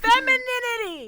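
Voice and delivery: high, loud proof of the word; loud, high-pitched insistence; exasperated shout. Real, not acted. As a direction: belt out the word to settle it. Shouts the disputed word back.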